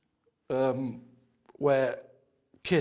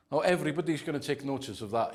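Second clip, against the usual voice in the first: normal talking voice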